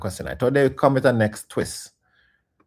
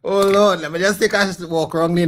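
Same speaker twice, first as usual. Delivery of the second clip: exasperated cry; 7 semitones higher, 6 dB louder than usual. Real, not acted. Exasperated plea to God.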